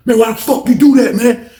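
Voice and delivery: high retold complaint; upset protest. Craig objects to ripped photo book.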